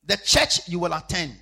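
loud, slow, high preaching cry; loud pulpit cry, drawn out; preaching shout. Acted. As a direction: proclaim it at full force. Preaching at full force.

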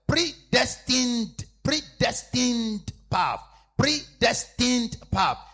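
predestined drawn out slowly, every syllable loud; stress on predestined. Shown as a demonstration. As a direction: spell out predestined slowly and loudly. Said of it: "don't, don't forget that word".

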